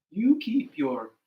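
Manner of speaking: slow, loud, spaced-out motto; deliberate advice. Acted. Mother Turtle giving advice to remember, slow and emphatic. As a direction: lay it out slowly for others to repeat.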